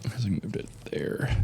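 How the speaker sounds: unvoiced whispered aside; whispering. Real, not acted. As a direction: whisper it under your breath. Aside while passing water.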